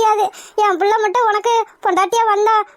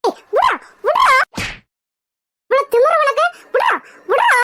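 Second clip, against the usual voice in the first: angry high yelling; 4 semitones higher than usual. Acted. Mother fights man dragging daughter.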